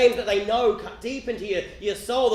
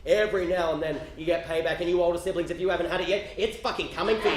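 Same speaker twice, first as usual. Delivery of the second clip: usual speaking voice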